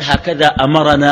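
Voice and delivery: low, slow recitation; drawn-out quotation. Retold or imitated. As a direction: stretch the last syllable out as a prompt. Quoting Ibn Umar, pausing for listeners.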